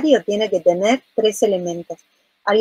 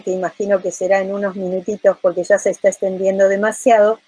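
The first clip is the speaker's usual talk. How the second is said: ordinary talk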